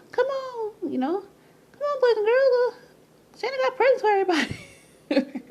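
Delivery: put-on high, loud, jolly come-on to kids; jolly Santa pitch. Vlogger mocking the Christmas Santa image, high jolly call. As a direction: sell Santa like a cheery holiday host.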